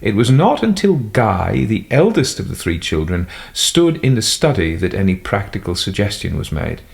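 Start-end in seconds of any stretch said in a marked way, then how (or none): none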